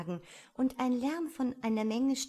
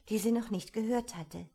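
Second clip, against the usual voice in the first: ordinary delivery, nothing marked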